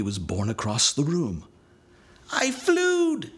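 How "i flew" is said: very high, louder gleeful scream; excited child's scream. A narrator's reading. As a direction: shriek it in midair delight. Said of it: "he screamed while still in midair".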